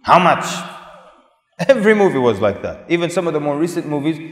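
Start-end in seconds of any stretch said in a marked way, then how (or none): 0.0-0.5 loud, blunt caricature of a leering buyer. Acted. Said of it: "he's always asking women".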